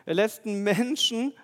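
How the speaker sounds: full-force preaching. Acted. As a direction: proclaim it loudly to the congregation. Preacher builds to climax. (laut und langsam predigend)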